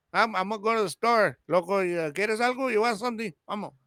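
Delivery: relaxed, casual mix of Spanish and English as at home; easy Spanglish between family members, how we talk; natural Spanglish. Shown as a demonstration. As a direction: toss it off like family at the house. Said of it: "That's how we talk".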